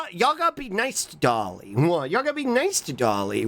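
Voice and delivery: ordinary delivery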